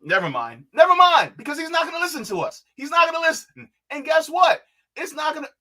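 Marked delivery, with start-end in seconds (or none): 0.7-1.3 high, loud burst of frustration; exasperated outburst. Real, not acted. Host giving up on warning the candidate, loudly exasperated. Gives up, then repeats it exploding. 1.4-5.5 high-pitched exasperated ranting; vent, pitched high and fed up; real frustration. Real, not acted. Host complaining he's not gonna listen to us, frustrated. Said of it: "I'm, I'm frustrated".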